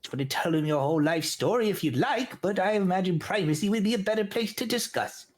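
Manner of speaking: high, curt gnome voice; irritated gnome elder. Acted. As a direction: brush off the story impatiently. Gnome voice continues.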